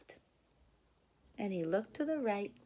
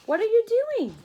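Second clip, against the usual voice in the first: curious caterpillar; 11 semitones higher, 14 dB louder, much faster than usual. Acted. Charlie asks the rabbits.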